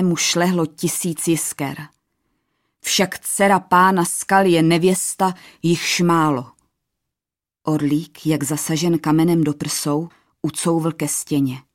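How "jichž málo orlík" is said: usual speaking voice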